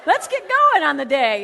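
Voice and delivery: high, loud, laughing greeting; cheery morning chat with his feet; chirpy chatter. Retold or imitated. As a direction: sing it out to old pals.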